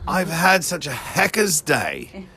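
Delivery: slow, put-on Australian accent; mock Aussie, drawn out. Echoing her line in a mock Australian accent. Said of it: "your Aussie voice".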